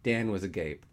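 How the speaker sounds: ordinary delivery, nothing marked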